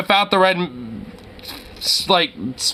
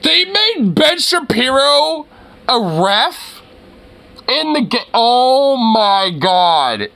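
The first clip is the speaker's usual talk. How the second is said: incredulous shriek; 6 semitones higher, slower than usual. Real, not acted. Sees Ben Shapiro as ref.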